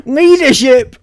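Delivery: loud and high, mocking the word; Leadership, blurted out in disbelief; incredulous exclamation. Real, not acted. Vlogger scoffing at a commenter's claim, loud disbelief.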